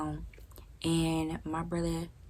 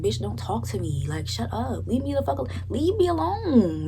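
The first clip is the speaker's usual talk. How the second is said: rising from annoyed to a high furious yell; building retold rant, high and loud; angry outburst; 6 semitones higher, 5 dB louder than usual. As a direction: snap at him, then blow up. Retelling hanging up on brother.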